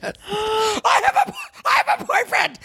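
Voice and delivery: high squealing scream, bursting out; screamed outburst. Retold or imitated. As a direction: blurt it out in a very high shriek. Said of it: "I'm sorry that I screamed that".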